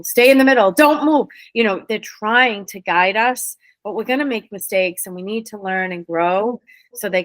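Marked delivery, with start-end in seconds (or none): 0.0-1.3 urgent warning. Retold or imitated. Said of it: "You're driving".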